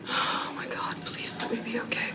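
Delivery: soft, urgent whispered prayer; frightened whisper. Real, not acted. Praying alone in her shelter, whispering in fear. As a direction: breathe the plea under your breath.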